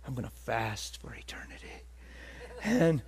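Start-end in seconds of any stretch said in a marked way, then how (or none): 0.1-1.8 excited secret whisper to himself. Retold or imitated. Preacher recalls his private vow, whispered. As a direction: breathe the vow in a hush. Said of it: "I was like".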